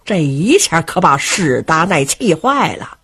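plain reading voice

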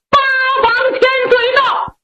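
high, fast ringing announcement; herald's call. Acted. Herald at the gate announcing the prince, high ringing call. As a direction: call the arrival out across the hall.

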